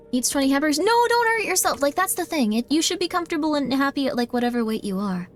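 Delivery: usual speaking voice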